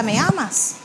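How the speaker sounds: gentle open question, not a demand; pregunta. Acted. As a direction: ask it, leaving the choice open. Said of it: "Dios te hace la pregunta". (pregunta abierta, sin exigir)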